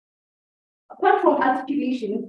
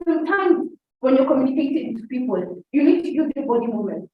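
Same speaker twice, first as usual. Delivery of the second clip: ordinary talk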